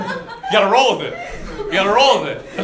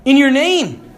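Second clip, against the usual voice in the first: slow, high, emphatic protest; pleading protest; 5 semitones higher, 3 dB louder, slower than usual. Acted. Shut-out followers plead with the Lord, pleading protest. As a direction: plead your record, leaning on each phrase.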